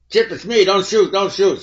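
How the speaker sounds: retold desperate don't-shoot scream; panicked yell. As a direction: yell it like a gun is pointed at you. Cop begging not to be shot.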